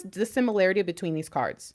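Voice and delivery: ordinary talk, nothing marked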